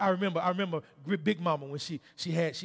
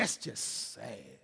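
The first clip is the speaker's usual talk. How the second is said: low, slow, hushed disapproval; scolding; 6 semitones lower, 6 dB quieter, slower than usual. Retold or imitated. Preacher has congregation judge his disobedience, low slow scolding. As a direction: imitate a drawn-out disappointed reproach. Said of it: "and her scolding words".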